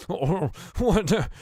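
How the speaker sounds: high, groaning, broken words; dazed, pained gasping, slow and loud; waking in pain. Acted. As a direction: groan awake as pain shoots through you. Tortured dwarf shakes awake in pain.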